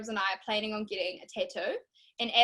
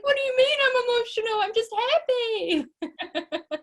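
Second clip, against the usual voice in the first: much higher and louder, on the edge of crying; tearful protest; 12 semitones higher, 5 dB louder, faster than usual. Retold or imitated. To her partner after he calls her emotional, tearful and high. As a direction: protest through tears, pitch shooting up. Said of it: "my happy tears turned into quite sad tears".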